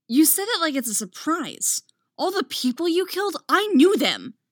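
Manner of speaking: loud, high angry outburst; angry snap. A narrator's reading. Anakin snaps at Count Dooku, loud and angry. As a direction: hurl it at him, furious. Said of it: "Anakin snaps".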